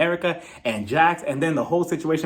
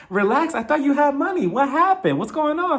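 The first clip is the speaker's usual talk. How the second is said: high-pitched mock concern; teasing disbelief; 9 semitones higher than usual. Viewer telling Lisa to relax about her shoes, teasing disbelief.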